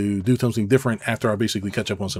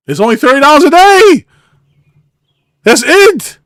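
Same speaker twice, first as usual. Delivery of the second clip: much higher and much louder rant; building exasperated yell; incredulous shouting; 13 semitones higher, 14 dB louder than usual. Real, not acted. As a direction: shout in disbelief.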